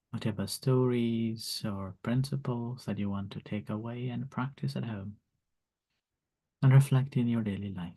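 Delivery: guided meditation. Acted. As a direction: lead them gently into calm. Leads loving kindness meditation.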